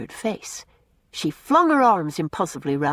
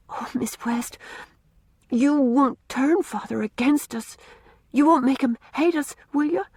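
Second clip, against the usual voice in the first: beseeching; 7 semitones higher than usual. A narrator's reading. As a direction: beg her not to turn father against them. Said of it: "she said beseechingly".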